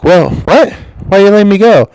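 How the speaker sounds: hammed-up disbelief; mock shocked. Fired employee asking the boss why, mock shock. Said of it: "you're like".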